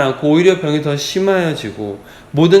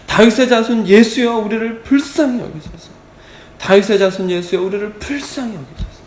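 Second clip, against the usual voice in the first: higher-pitched, pleading call to Jesus; earnest crying out; 6 semitones higher than usual. Acted. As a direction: cry out imploringly, acting the blind men. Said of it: "이렇게 간절히 부르짖습니다". (간절하게 부르짖듯이)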